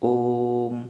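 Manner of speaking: ओम with the ओ held long and level; drawn-out om. Shown as a demonstration. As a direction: stretch the ओ of ओम like a chant. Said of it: "तो जब ओम हम बोलते हैं तो कैसे बोलते हैं".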